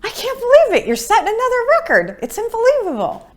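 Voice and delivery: hammed-up astonished doctor; amazed doctor. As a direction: squeal it like a thrilled doctor. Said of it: "he would go".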